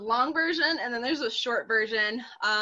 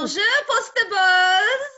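put-on slow, high, loud French-accented bonjour; 12 semitones higher, 8 dB louder, slower than usual. Co-host greeting listeners for the From Paris episode, hammed-up French accent. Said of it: "she comes tonight with a French accent".

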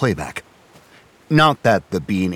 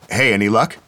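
louder, higher call across the beach; 5 semitones higher, 6 dB louder than usual. A narrator's reading. Man calling to friends down the beach, loud call. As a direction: call out a greeting from a distance. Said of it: "he called".

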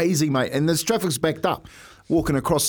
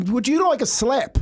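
higher, cheeky threat tossed back; mock challenge; 9 semitones higher than usual. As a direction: play a half-joking tough-guy challenge, higher. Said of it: "I'm going to him".

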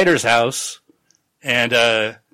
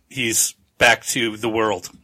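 ordinary talk

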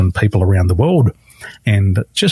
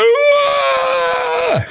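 high, pained crying cry of anguish; crying wail. Retold or imitated. Recalling client Paul breaking down, high crying wail. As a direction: let out a sudden wailing sob, high.

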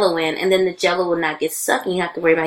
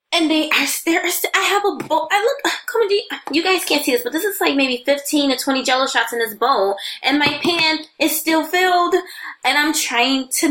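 excited; 8 semitones higher than usual. Real, not acted. Excited rant about Jell-O shots.

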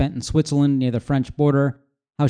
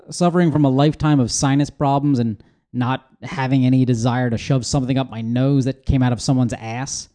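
normal talking voice

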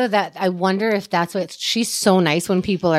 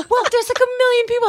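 high incredulous protest; 3 dB louder, faster than usual. Retold or imitated. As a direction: protest in disbelief, pitched high. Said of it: "I was like".

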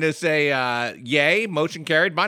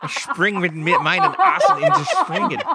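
high laughing mock German; fake German; 11 semitones higher than usual.

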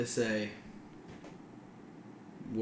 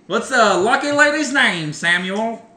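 brassy nineteen-thirties showman; old Tommy voice; 6 semitones higher than usual. Pretend. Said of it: "Is that the old Tommy voice?".

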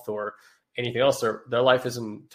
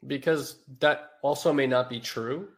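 ordinary talk

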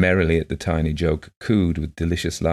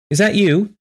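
high, louder, lifted woman's voice; blind woman's eager question; 7 dB louder than usual. A narrator's reading. Blind woman hailing a visitor in the woods, eager question.

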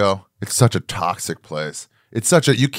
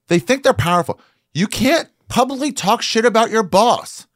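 much higher, louder indignant tirade; heated rant; 11 semitones higher, 3 dB louder than usual. Real, not acted. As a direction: vent at entitled workers. Ranting about entitled employees.

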